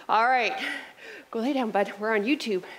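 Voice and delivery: normal talking voice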